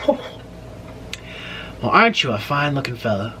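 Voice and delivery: lightly voiced Southern flirt; Southern accent.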